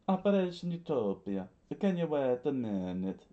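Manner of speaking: lightly voiced character breaking in; character interjecting. Acted character line, surprised interjection.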